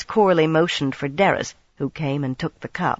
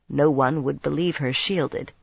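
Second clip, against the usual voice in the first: usual speaking voice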